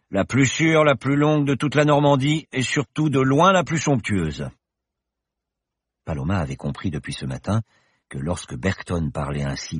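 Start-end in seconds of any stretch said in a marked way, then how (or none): 0.1-4.5 high, bright-spirited professor voice, louder; the confident pilot professor, merry; cheerful professor. Acted. Professor reassures Paloma in the plane, jaunty and loud. Bergton boasts of the runway.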